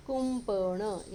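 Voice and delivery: कुंपण slow, with a long m before प; m sound shown. Shown as a demonstration. Teacher showing children the anusvara, कुंपण stretched on its m. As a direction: hold the m in कुंपण. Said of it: "यहाँ म pronunciation आया अनुस्वार का".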